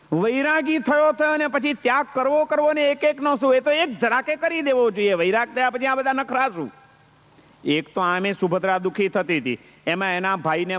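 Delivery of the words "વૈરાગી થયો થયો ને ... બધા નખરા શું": scornful taunt. Acted. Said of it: "એને માટે ઘસાતું બોલે છે ધનાજી".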